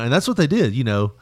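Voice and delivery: normal talking voice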